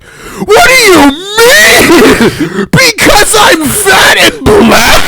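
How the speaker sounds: mock outraged shriek, high and drawn out; shrill mock offense, stretched slow; squealing imitation of an offended coworker; indignant high squeal. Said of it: "She was like".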